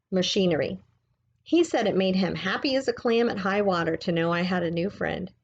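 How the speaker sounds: ordinary delivery, nothing marked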